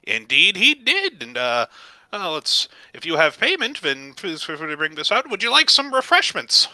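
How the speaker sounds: high, loud welcoming gnome; effusive gnome proprietor. Acted. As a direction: greet them grandly as an eager gnome shopkeeper. Gnome proprietor offers refreshments.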